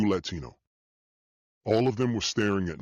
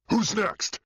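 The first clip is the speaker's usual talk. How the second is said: roar; 13 semitones higher than usual. Acted. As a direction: bellow it at the men on the floor. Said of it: "Mahoney roared".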